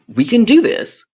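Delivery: high, eager, can-do declaration; upbeat self-pep-talk. Retold or imitated. Retelling her own optimistic resolve.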